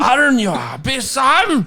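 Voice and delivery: high, loud over-the-top Hagrid; mock Hagrid. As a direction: belt it like a cartoon giant. Hammed-up Hagrid imitation.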